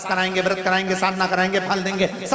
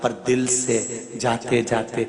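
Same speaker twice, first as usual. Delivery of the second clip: gentle sincere counsel; 7 semitones lower than usual. Acted. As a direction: advise them tenderly, lower. Lower, soft preaching close. (धीमे, कोमल स्वर में सच्ची सलाह)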